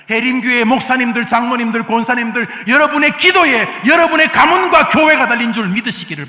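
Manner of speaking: very loud, high exhortation; thundering roll-call appeal, pitched high; full-force preaching. Real, not acted. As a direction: cry out to the whole congregation. Climactic appeal to the whole congregation.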